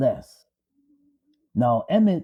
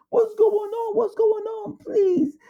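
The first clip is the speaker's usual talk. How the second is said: much higher, crying frantic plea; wife begging the men at the door, frantic; slower than usual. Retold or imitated. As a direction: beg in panic, voice cracking high. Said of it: "His wife came out frantically".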